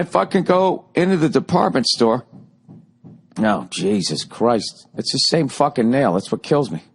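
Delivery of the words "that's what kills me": ordinary delivery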